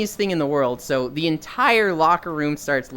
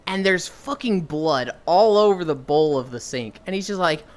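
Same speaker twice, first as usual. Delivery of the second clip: ordinary delivery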